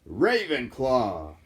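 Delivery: loud, very slow house call; hat proclaims Ravenclaw; hat announcing. Acted. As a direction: stretch the name out to the hall.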